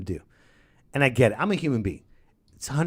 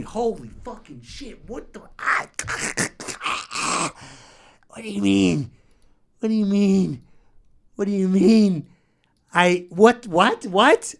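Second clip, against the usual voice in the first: loud, higher disbelieving cries; exasperated outburst; 6 semitones higher, 3 dB louder than usual. Real, not acted. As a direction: blurt it out in disbelief.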